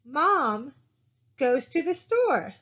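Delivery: "Mom" stressed, a pause, then "store" pitched high and falling. Shown as a demonstration. Teacher showing sentence cadence, "Mom" and "store" stressed. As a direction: lean on "Mom" and "store", slowly. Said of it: "my inflection is more on mom and store".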